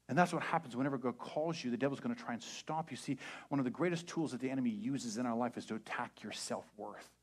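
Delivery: usual speaking voice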